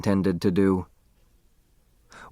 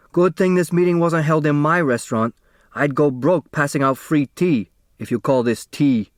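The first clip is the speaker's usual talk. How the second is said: raised, contemptuous grumble; grumbling restaurant owner; 4 semitones higher than usual. A narrator's reading. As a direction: scoff at the tea. Mister Hu complaining.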